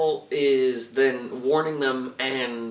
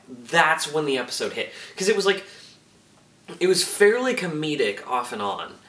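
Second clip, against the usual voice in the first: ordinary delivery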